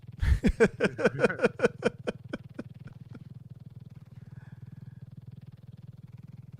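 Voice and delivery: shrieking breathless laughter; high airy hysterical laugh; long high wheezing laugh. Real, not acted. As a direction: crack up completely. Laughing at Jared joke.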